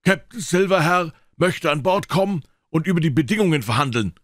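high, loud formal hail; herald's call, pitched very high. A narrator's reading. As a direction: shout the request up from a distance. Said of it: "rief er".

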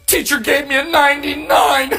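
high, laughing imitation of her sob; mock tearful wife. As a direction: whine the score like a heartbreak. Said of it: "She goes".

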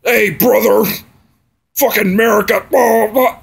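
a mocking country bro shout. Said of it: "go around and saying".

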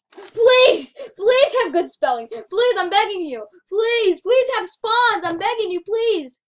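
loud, high begging; hammed-up plea, each please cried out; desperate pleading. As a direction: beg at the top of your voice. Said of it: "Let's hope my pleads".

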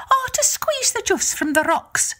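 high, lilting Irish wife; Una's voice. Acted. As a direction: sweetly put off the visitor in a high voice. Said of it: "she said".

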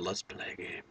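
eerie whispered horror-movie voice; breathy menacing whisper; Saw villain voice. Retold or imitated. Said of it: "I was playing Saw and said".

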